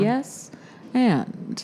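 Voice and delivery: goofy radio voice. Pretend. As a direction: put on a silly radio host voice. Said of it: "a goofy voice like this".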